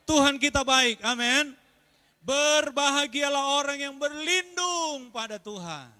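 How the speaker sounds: loud, high, fervent sermon voice; loud pulpit proclamation; preaching at full force. Acted. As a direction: drive the verses home to the congregation.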